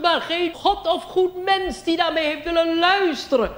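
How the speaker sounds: high, loud, slow quavering prophet voice; old angel. Pretend. Old angel warned centuries ago, high and slow. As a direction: drag it out like an ancient angel. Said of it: "een hele oude witte engel".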